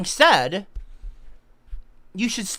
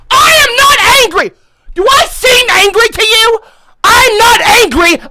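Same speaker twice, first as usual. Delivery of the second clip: much higher, very loud shouted denial; mock angry denial; 14 semitones higher, 11 dB louder than usual. Ginger streamer to chat, comic shouted denial. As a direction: yell that you're not angry, proving the point.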